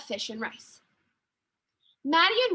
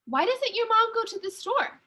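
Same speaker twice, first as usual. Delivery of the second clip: Sophia's voice; 8 semitones higher than usual. A narrator's reading. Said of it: "Sophia asked".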